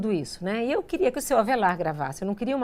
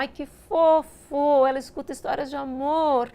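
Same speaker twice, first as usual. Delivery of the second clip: high, loud, slow cooing; mocking people who find it cute, cooing; mock gushing; 5 semitones higher, 3 dB louder than usual. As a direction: coo it like a sugary fan.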